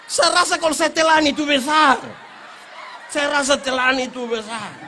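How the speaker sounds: very loud, slow, shouted with laughter; loud laughing punchline. Real, not acted. Preacher delivering the coconut punchline, slow loud laughing shout.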